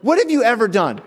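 high-pitched indignant challenge; mock an outraged customer demanding an answer; irate lawyer. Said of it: "full front ire of this man".

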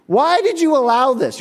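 high, pleading mock whine; upset worker blaming his manager, in a high whine; whiny complaint. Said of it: "It's like".

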